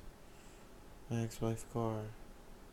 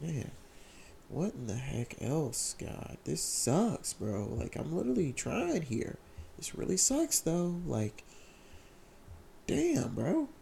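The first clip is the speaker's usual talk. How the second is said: frustrated outburst; 5 semitones higher, slower than usual. Retold or imitated. Retelling praying in the truck, exasperated rising cry. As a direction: vent it to God, climbing higher each line. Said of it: "legit talking like that".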